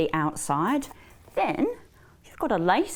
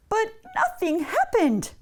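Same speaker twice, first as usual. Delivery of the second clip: high, singsong storyteller's dismay; exaggerated disappointment for children; dramatic letdown; 13 semitones higher than usual. A narrator's reading. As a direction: swoop up high, then fall away. Pull fails in children's story.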